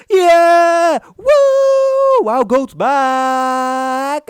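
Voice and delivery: very high, loud cheering, the last words slow; hammed-up crowd welcoming a star back; mock fan cheering. Imitating fans greeting Lily Tino's return, in overblown cheering. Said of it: "everyone's like".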